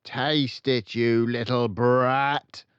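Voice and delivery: loud, slow, high tyrant headmistress; snarling order. A narrator's reading. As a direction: spit the insult at the brat. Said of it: "the Trunchbull said".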